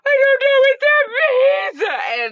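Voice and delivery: put-on very high, loud, drawn-out kid wail; mock tantrum cry, squealed; imitating wailing child. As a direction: shriek it slowly like a crying little boy. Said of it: "And he was like".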